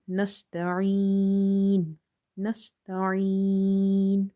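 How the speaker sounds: nasta'een with the final ee held long; nasta'een with a long ending; stretched ending. Shown as a demonstration. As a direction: draw out nasta'een to three counts. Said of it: "stretching up to three".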